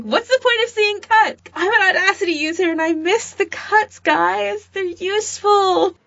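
high mocking voice of a baffled fan; mock Audacity user. Host mimicking a tweeting Audacity fan, high mocking whine. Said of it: "It's like".

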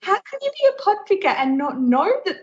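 can't believe she doesn't know; incredulous. Real, not acted. Incredulous at friend's ignorance.

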